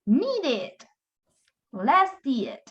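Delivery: "needed, lasted" with the -ed as its own syllable; sounded -ed ending. Shown as a demonstration. Teacher shows E D can sound, separate final syllable pronounced. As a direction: model "Needed", "lasted" slowly, both syllables full. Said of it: "podemos hacer sonar esa E D".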